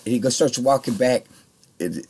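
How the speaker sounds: usual speaking voice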